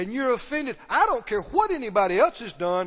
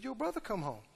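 subdued report; 12 dB quieter than usual. Acted. Servant telling the older son the news, subdued. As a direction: break the news very softly.

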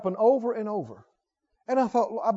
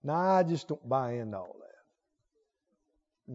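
mock skeptic; 3 semitones lower, 9 dB quieter, much slower than usual. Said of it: "and they think".

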